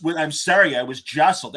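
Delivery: ordinary talk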